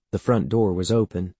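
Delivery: plain reading voice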